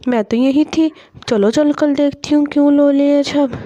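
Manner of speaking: put-on lisping child, r turned to l; small girl puzzled by the crying, lisping her r's; little girl asking her crying mother what happened, childish lisp. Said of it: "तभी मिंकी यहाँ बाहर से आ रही होती है".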